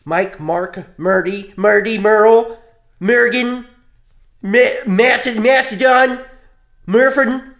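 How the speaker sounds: loud, high rattling off of names; mock name list. Friend mocks corny kid names in a loud high chant. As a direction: shout out silly names, getting sillier.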